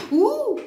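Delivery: high, loud "ouh"; startled whoop. Real, not acted. Card reader reacting to a card, high startled whoop. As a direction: let out a sudden high-pitched whoop.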